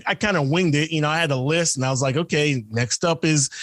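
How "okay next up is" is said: normal talking voice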